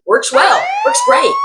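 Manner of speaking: high, loud mocking echo of the review; sarcastic quote. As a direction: sneer it back in a squeaky tone.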